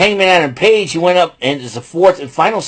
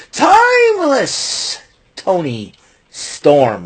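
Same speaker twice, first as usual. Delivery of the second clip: ring announcer; 5 semitones higher, much slower than usual. Acted. Hyping the champion's name.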